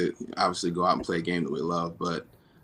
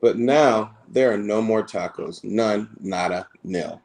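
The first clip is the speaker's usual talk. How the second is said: louder, higher, lively storybook read-aloud; reading to children; 4 semitones higher, 6 dB louder, slower than usual. Acted. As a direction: read it big and animated for the kids at the event. Reads picture book to children.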